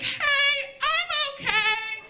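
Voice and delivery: very high, strained, wobbly chirp; fake cheerful reassurance. Woman in a bathroom stall calling to Gloria, high tearful chirp. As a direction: squeak it to seem fine while crying. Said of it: "I was like".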